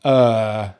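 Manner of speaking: long, held "uh" vowel with no R; "uh" drawn out, jaw just open; long vowel demo. Shown as a demonstration. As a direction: hold "uh" as one steady vowel. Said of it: "this long vowel sound sounds like this".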